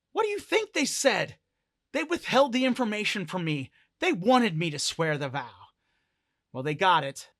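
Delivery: loud outburst, starting high and coming down; frustrated retort. Acted. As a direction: throw the question back in exasperation. Hawking snaps back, frustrated, in argument.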